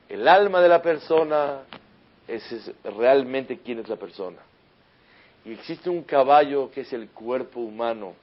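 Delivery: loud, high, emphatic sermon delivery; fiery preaching. Acted. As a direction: drive the lesson home to the congregation. Rabbi preaching at full force.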